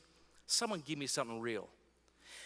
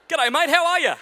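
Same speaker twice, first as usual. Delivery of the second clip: high, loud hammed-up cheery hello; laughing, over-the-top g'day; mock cheery greeting; 12 semitones higher, 14 dB louder than usual. Voicing happy Christians greeting.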